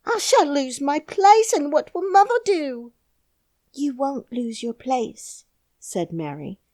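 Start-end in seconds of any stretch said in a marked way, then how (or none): 0.1-2.9 frightened Yorkshire maid; half crying. A narrator's reading. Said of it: "half crying". 3.7-5.4 put-on lightly higher little girl, slow and grave; a girl with a secret, slowly; solemn little girl; young girl. A narrator's reading. Mary's girl voice.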